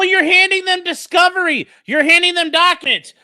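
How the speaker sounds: loud, high indignant objection; real exasperation at full voice; exasperated outrage. Real, not acted. Outraged at the lawyer on video.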